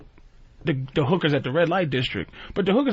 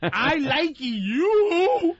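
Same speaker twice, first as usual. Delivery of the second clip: slow, higher mock broken English, words dragged out; 9 semitones higher, much slower than usual. Mocking the Brazilian girls' broken English, slow and higher. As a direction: imitate a Brazilian girl fumbling her English. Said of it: "'Cause the other ones be like".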